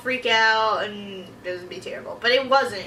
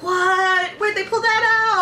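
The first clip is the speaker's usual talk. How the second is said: high, loud shriek of disbelief; hammed-up outraged viewer reaction; freaking out; 8 semitones higher, 5 dB louder, slower than usual. Viewer reacts to the movie's twist, freaking out. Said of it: "I'm freaking out over this movie".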